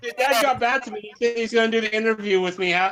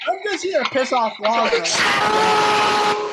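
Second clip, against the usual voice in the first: slow taunting shout; 7 semitones higher, slower than usual. Real, not acted. Caller taunting the streamer.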